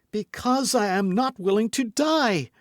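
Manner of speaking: very high, loud desperate cry; crying out in fear. A narrator's reading. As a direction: plead while struggling. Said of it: "cried Milady, struggling".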